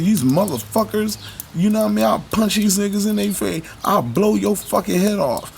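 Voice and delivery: mock threat. Mocking a self-proclaimed God who threatens people, mock threat.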